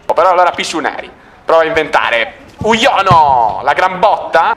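normal talking voice

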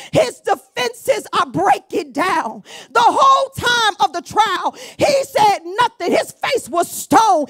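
building preacher's cry; full-force preaching. Real, not acted. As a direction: drive it home to the congregation.

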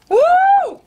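sudden high-pitched whoop; high woo. Real, not acted. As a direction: yelp at the sour taste. Reacting to sour candy.

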